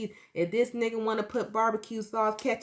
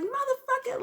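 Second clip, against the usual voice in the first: furious yell. Retold or imitated. Striking the boyfriend, high furious yell. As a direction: scream it as you strike. Hitting him with a bottle.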